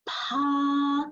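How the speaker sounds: "pa." with a strong burst of air; aspirated pa. Shown as a demonstration. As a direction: puff the air out on "pa.". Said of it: "make sure the strong A come up from a mouth".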